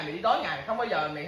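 normal talking voice

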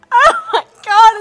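mum overwhelmed. Retold or imitated. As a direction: play mum bursting into tears. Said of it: "she just went".

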